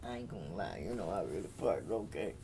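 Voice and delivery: a low, fast, knowing admission; sly confession. Real, not acted. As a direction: drop your voice way down and own it. Confessing wild partying.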